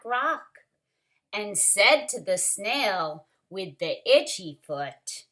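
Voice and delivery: put-on lively read-aloud for children; story reading. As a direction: read it like a picture book to the class. Story narration continues.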